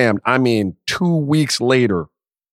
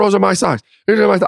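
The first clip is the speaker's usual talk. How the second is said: put-on higher, protesting child's voice; squabbling kid; 7 semitones higher, faster than usual. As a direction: imitate a kid fighting over socks. Voices his sons fighting over socks.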